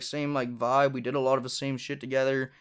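usual speaking voice

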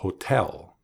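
"hotel" as ho-TEL, weight on TEL; stress on second syllable. Shown as a demonstration. Teacher modelling the word for learners, second-syllable stress in "hotel". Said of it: "emphasis on the second syllable".

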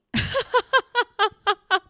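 high, loud burst of giggles; helpless staccato cackle; laughing fit. Real, not acted. Host realizing their own slip, high loud laughing fit.

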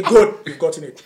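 loud, emphatic imitation of dad; dad's way of talking. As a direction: proclaim it like a father catching you out. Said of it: "that's how he talks".

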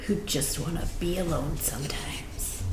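whispering. Pretend. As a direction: whisper it like a secret. Mock confession whispered.

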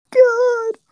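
high exasperated groan; despairing cry to the sky. Retold or imitated. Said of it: "I was like".

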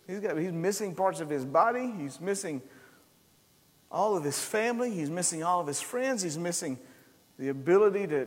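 ordinary talk, nothing marked

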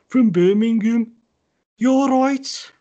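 high, loud mock Brummie; Birmingham accent. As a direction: belt out a caricature Brummie greeting. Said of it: "Birmingham's like".

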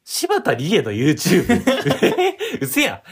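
high, slow incredulous laugh; can't believe it, laughing; laughing disbelief. Real, not acted. Disbelief at Shibata Rie mention.

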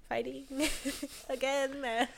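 high start, dissolving into giggles; nervous laughing reassurance. Retold or imitated. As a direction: talk yourself down, giggling midway. Retelling nervous self-reassurance, laughing.

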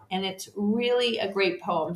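ordinary talk, nothing marked

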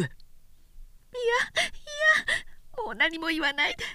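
high, hurried refusal; distressed young woman. A narrator's reading. Oyuki refusing to hear Sōshichi, high and hurried. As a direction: cover her ears and beg him to stop. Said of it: "両手で耳を塞いだ".

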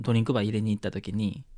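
normal talking voice

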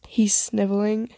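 usual speaking voice

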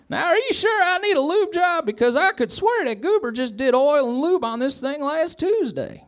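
very high, squeaky deputy voice; Barney voice. A narrator's reading. Barney questioning the mechanic, high squeaky character voice.

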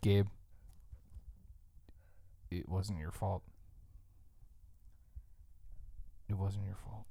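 low, hushed, gentle absolution; soft and grave, leaving long pauses; mock consolation. Host consoling fired Gabe, hushed and tender.

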